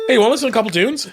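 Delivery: high, loud, bouncy question; eager offer. Real, not acted. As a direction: pitch the songs like a radio host. Host pitches playing songs.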